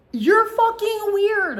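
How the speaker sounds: high, loud, slowly dragged out; loud mock-disgusted exclaim at a creep; disgusted outburst. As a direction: draw it out in appalled disbelief. Reacting to creepy text.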